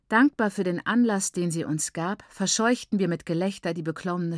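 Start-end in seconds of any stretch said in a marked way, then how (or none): none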